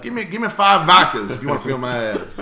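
loud, high mock bragging with laughs; hammed-up tipsy boast, cracking up; drunk show-off. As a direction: drunk roommate selling his abs for drinks, loud and high. Drunk friend showing off abs.

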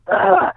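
high-pitched grossed-out groan; disgusted "ugh". Retold or imitated. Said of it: "like, disgusted".

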